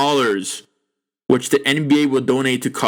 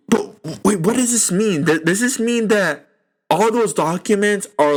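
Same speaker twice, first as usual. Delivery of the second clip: normal talking voice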